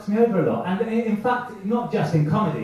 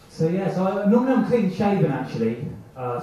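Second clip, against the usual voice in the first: ordinary talk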